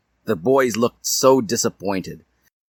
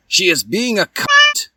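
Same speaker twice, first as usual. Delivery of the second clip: shrieking high yell, pitched way up and loud; 12 semitones higher, 5 dB louder than usual. Acted. Edward yelling about Bella, high loud yell. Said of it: "he yelled".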